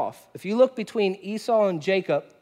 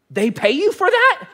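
high, incredulous squeak of disbelief; a laughing, high-pitched they-pay-you-for-that; amazed question; 11 semitones higher, 5 dB louder than usual. Retold or imitated. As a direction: play the stunned stranger, pitched way up with a laugh. Man amazed at pastor's pay.

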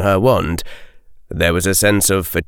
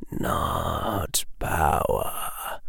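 slow, hushed, dry whisper; slow rasping whisper from the mist; sibilant whisper; 5 semitones lower, 11 dB quieter, much slower than usual. A narrator's reading. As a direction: whisper it like dust from the dark. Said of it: "whispered the voice".

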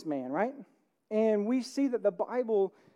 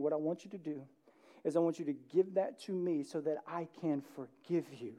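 gentle voice of God; 4 semitones lower, 7 dB quieter than usual. Acted. God's voice, gentle.

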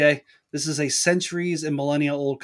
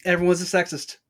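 mock slogan; 5 semitones higher, 8 dB louder than usual.

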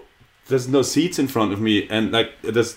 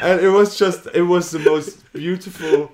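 laughing; 7 semitones higher than usual. Real, not acted. Laughing through the story.

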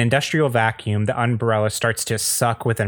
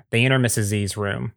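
ordinary talk

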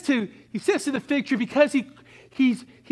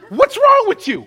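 much higher and louder, "wrong" hit hard; preacher shouting at the church; loud rebuke; 12 semitones higher, 8 dB louder than usual. Acted. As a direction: challenge the whole church. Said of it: "Let me say to the church".

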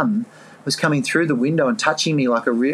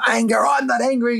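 high, mock-indignant protest; defensive denial; 10 semitones higher than usual. To himself in the car, denying his anger, mock-indignant. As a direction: swear you're not angry, pitched up.

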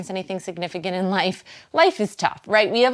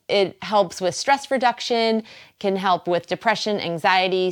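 ordinary delivery

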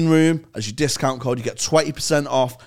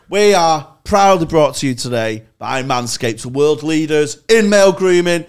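ad read; 6 dB louder than usual. Acted. Sponsor read opening.

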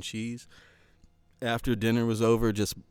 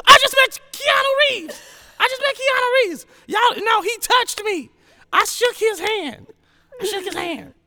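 high, loud starstruck gushing; starstruck squeal; 9 dB louder than usual. Retold or imitated. Telling friends about meeting Keanu, high excited squeal.

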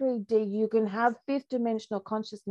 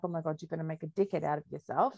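panicky ego voice; 5 semitones lower, 7 dB quieter, faster than usual. Retold or imitated. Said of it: "my ego's like".

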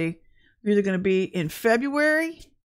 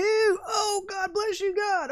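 much higher, crying with relief; relieved tearful thanks; 11 semitones higher, slower than usual. Real, not acted. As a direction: thank God through tears. Finds the clock, relieved.

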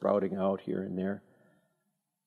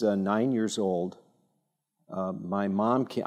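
normal talking voice

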